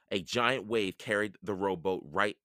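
ordinary delivery, nothing marked

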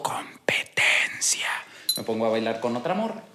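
whispered secret trick. Acted. Revela su truco en secreto. (susurro confidencial)

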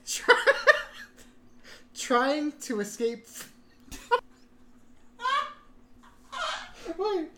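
high, loud, laughing hysterically; words squeezed out through laughter; laughing fit. Real, not acted. Laughing fit over synopsis.

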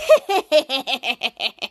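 imitated mischievous villain laugh; lightly voiced impish chuckle; toddler's laugh. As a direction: cackle like the little troublemaker. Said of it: "the Toddler's like".

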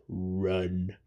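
low, very soft ape growl of run; a quiet, heavy one-word warning from the ape; Maurice the ape impression. Mimicking the ape telling the kids to run, low and soft. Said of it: "Maurice from Dawn of the Planet of the Apes".

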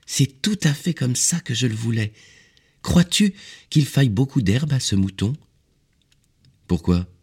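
put-on high, pleased child-like voice; delighted small boy, then curious; little prince. A narrator's reading. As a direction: pitch it up like an eager child.

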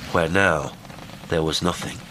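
ordinary delivery, nothing marked

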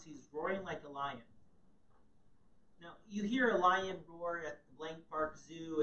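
usual speaking voice

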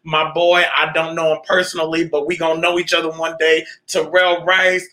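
ordinary delivery

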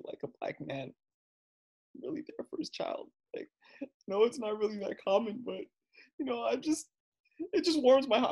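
hammed-up tearful admirer; imitating gushing lady. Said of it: "she's like".

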